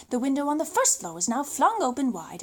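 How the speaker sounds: plain reading voice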